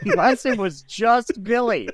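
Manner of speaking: high-pitched words breaking up with laughter; helpless laughter. Real, not acted. Game master repeating the joke to players, helpless laughter. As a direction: barely get it out for laughing.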